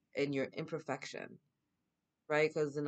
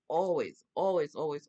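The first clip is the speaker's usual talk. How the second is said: each always hit louder, ringing out; emphatic repeats; 3 semitones higher, 8 dB louder than usual. Real, not acted. Telling viewers guides are always there, insistent louder stress. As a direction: pound every always louder and louder.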